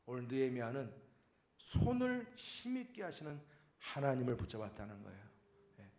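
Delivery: normal talking voice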